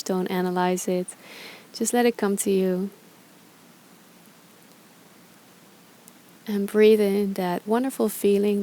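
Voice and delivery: calm, unhurried meditation guide with long pauses; guided meditation. Acted. Yoga teacher guiding followers through gratitude reflection, calm meditation voice. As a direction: ease listeners through each breath, leaving space between phrases.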